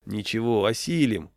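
ordinary delivery, nothing marked

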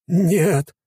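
high loud horrified shout; horrified cry. A narrator's reading. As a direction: cry out in sudden terror. Commander horrified. (испуганный крик)

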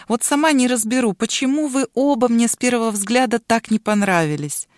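plain reading voice

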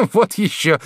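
high, indignant exclamation; indignant shout. A narrator's reading. Lover rejects woman's retraction, indignant shout. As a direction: burst out in protest. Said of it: "закричал Жак".

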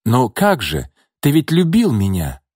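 unsure, searching for reassurance; uncertain. A narrator's reading. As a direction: Nina doubting herself before Gordey. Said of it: "неуверенно произнесла Нина".

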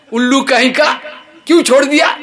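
high, loud mock scolding, laughing through it; the master berating the servant, playfully hammed up; scolding shout. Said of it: "গালাগাল দিচ্ছে চকরকে".

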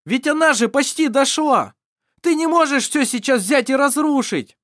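high, loud, desperate cry; desperate shout. Acted. Vassar shouting at Mar in despair, high and loud. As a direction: yell it in despair. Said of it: "Вассар кричал в отчаянии".

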